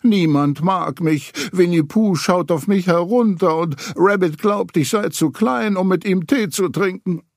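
lamenting alone in the forest, whiny and sad; whining Piglet. A narrator's reading. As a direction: moan to yourself like a lonely little piglet. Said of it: "jammerte vor sich hin".

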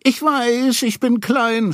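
high, small voice caught in a sob; sobbing Piglet. A narrator's reading. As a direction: sob it out like a hurt little piglet. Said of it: "schluchzte Ferkel".

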